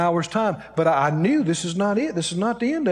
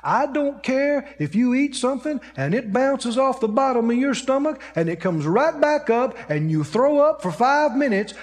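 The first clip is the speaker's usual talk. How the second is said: ordinary talk, nothing marked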